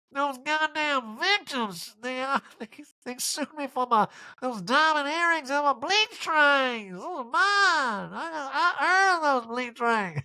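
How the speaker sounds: very high, slow, whiny fake cry; hammed-up wailing diva; Erica impression, mock sobbing. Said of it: "Erica voice, mock crying".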